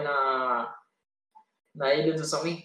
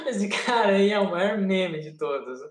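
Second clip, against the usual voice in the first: laughing; 4 semitones higher than usual. Real, not acted. Laughs about the character.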